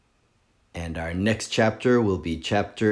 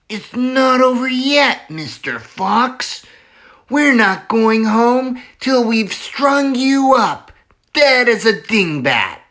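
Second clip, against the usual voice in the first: high, louder villain shout; shouting threat; 13 semitones higher, 6 dB louder than usual. Acted. Bean taunting the fox down the hole, shouting.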